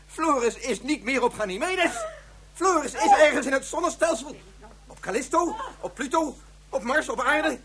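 desperate confession building in panic; distraught outburst. Acted. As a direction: voice climbing to a near shout. Scientist admits child is lost.

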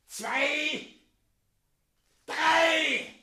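put-on a mad old mayor losing it, voice cracking high; raving madman. Mayor raving madly at his friends, shrieking high.

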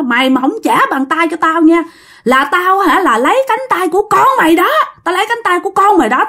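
high, insistent threat without shouting; irate ghost scolding, mildly played; acted angry cursing. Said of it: "ổng chửi vậy á".

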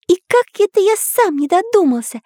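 high character voice, not actually hushed; muttering artist. A narrator's reading. Artist leafing through a book, high-voiced musing. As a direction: marvel to yourself in a high-pitched voice. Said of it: "бормотал художник".

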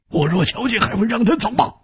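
high, icy challenge; cold retort. A narrator's reading. Swordsman asked whether he saw the attacker, coldly retorting. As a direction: throw the question back, pitched high. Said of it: "易点红冷冷地说".

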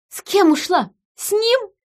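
very high, outraged questions; incredulous bellow. A narrator's reading. Woman answering Gregory's plan, shrieking indignantly. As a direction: shoot the questions back in disbelief. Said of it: "гаркнула я в ответ".

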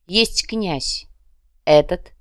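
ordinary delivery

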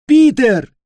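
high, loud desperate shout. Acted. Cricket screaming for Peter over the noise. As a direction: scream his name to reach him. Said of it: "закричал Сверчок".

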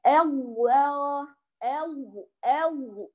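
أو syllables alternating light then heavy lam; mock wrong lam. Shown as a demonstration. Teacher imitating the wrong lam of Allah to students. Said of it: "فيقول".

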